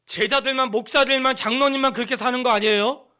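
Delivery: high, loud, fervent preaching; preaching at full force. Real, not acted. Pastor urging every believer, in full preaching force. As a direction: drive the point home to the whole church.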